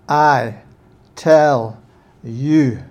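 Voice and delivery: "I tell you" slow and loud, each word punched apart; preacher lands "I tell you" with weight; stress on I TELL YOU. Shown as a demonstration. Preacher showing how Jesus stressed it, emphatic. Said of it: "I believe he put that emphasis on it".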